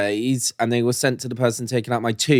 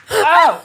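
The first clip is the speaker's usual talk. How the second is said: pained ow; 6 dB louder than usual. Real, not acted. Laughing hurts his stitched mouth.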